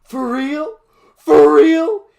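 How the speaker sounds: very high, very loud screech. Acted. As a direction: shriek it with the knife out. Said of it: "he screeched".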